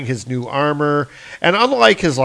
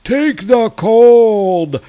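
much higher, louder slow battle yell; giants shouting; 10 semitones higher, 8 dB louder, much slower than usual. Acted. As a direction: scream for the kill like a mob. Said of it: "It's like".

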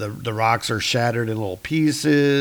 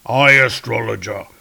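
louder, booming royal voice; a king questioning his court astrologer; regal Odin; 7 dB louder than usual. Acted. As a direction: proclaim like an aged king on his throne. Odin again.

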